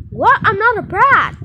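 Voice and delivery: very high, loud shriek; furious denial. Pretend. Kid shrieks at her sister for calling her a brat. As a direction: shriek it back in fury. Kid yells denying insult.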